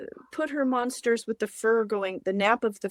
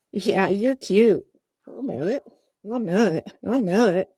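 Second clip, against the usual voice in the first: usual speaking voice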